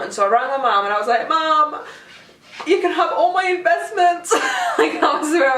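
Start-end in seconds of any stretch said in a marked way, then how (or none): 1.3-4.3 high hammed-up plea to Mum; mock dramatic plea. Retold panicked call to mum. 4.3-5.6 words through a burst of laughter; laughing. Real, not acted. Laughing while retelling the crash.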